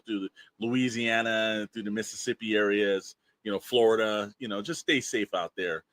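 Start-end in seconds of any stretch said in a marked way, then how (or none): none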